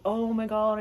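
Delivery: ordinary talk, nothing marked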